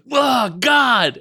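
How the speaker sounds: high, loud startled yelp; startled cry. Retold or imitated. Daughter startled by her dad, high loud yelp. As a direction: jump with fright at dad's greeting.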